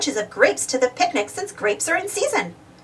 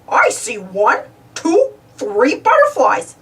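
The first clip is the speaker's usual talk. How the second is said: slow, lightly voiced goofy counting; 3 dB louder, slower than usual. Goofy counts butterflies on the road, slow goofy voice. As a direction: count them out slow and dopey. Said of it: "he said".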